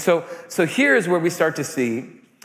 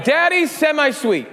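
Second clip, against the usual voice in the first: lightly voiced excited child, higher; kids' voice; 10 semitones higher than usual. Kids telling Daddy they chose semi-sweet, lightly voiced child. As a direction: chirp it like a proud little kid. Kids naming the chocolate chips.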